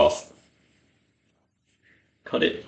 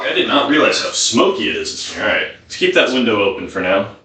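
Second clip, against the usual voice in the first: ordinary delivery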